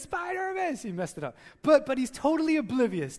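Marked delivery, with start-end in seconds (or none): 0.0-0.8 put-on high, eager kid's shout; excited little boy. Father retelling his son's mistaken cry, high pitched. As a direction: call it out like an excited toddler. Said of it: "he goes".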